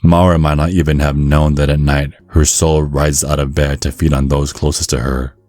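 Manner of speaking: ordinary talk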